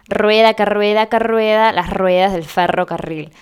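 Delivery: rhythmic "rueda que rueda" with trilled initial r; rolled-R tongue twister. Shown as a demonstration. Versito practised by chicos, "rueda que rueda" with rolled trills. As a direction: roll the r on each "rueda" and in "ferrocarril". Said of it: "un versito que hacemos que los chicos repitan".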